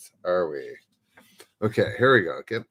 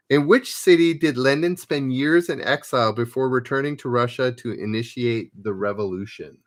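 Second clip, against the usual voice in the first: louder, announcing quiz question; quiz host read; 2 semitones higher, 5 dB louder than usual. Acted. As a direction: read the question out to the players like a quizmaster. Reads quiz question aloud.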